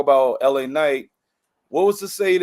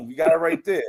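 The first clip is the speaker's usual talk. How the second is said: ordinary talk, nothing marked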